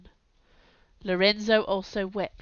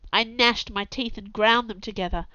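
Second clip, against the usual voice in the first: plain reading voice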